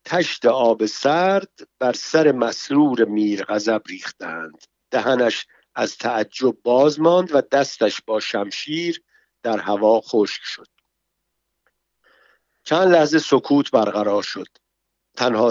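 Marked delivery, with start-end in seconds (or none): none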